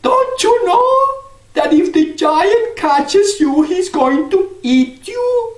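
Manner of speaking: put-on very high, slow old woman's voice; old crone. Old woman in the castle questions Jack, very high and slow.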